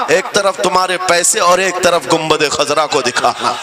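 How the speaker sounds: ordinary talk, nothing marked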